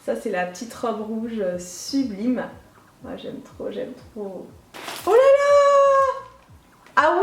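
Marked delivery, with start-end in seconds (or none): 3.0-4.5 near-whispered admiration; barely voiced delight; hushed delight. Real, not acted. Admiring the red dress to herself. 5.1-7.2 high, loud, drawn-out cry of surprise; long high-pitched squeal of shock; shocked exclamation. Real, not acted. Startled by the size of rings.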